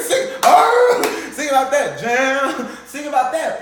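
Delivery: high, loud jeering yell; mocking shout; loud taunt. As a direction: jeer at him to sing. Taunting loudly.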